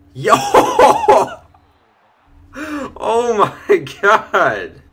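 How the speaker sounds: high, loud whoop of disbelief, then drawn-out words; excited whoop. Real, not acted. Gamer reacting to his fullback's run, excited whoop. Big play lands in game.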